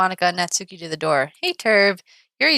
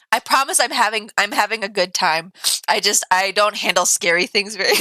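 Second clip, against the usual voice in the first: ordinary talk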